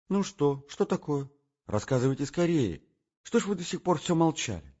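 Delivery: high, quick curious voice; pressing him to tell; impatient girl. Acted. Настенька нетерпеливо расспрашивает. (нетерпеливо, высоким голосом)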